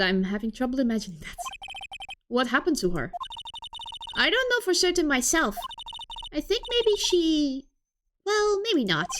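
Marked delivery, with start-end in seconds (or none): 4.2-9.1 higher, lightly acted girl voice; hesitant younger sister. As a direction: trail into doubt in a higher voice.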